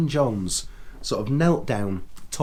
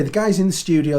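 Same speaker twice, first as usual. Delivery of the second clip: usual speaking voice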